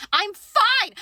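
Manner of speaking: loud, high, unconvincing reassurance; squeaky mock-brave outburst; high-pitched insistence. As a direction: insist on it as the voice shoots up. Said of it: "my voice is getting up and high like that".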